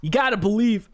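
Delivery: second go at the hype voice; Lex impression. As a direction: streamer hype retake. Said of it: "Lex is hard to do".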